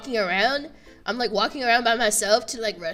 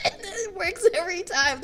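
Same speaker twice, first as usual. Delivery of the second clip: high, giggly delivery; tickled, words riding on laughter; laughing; 9 semitones higher than usual. Real, not acted. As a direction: talk through the tail of a laugh. Amused that the excuse works.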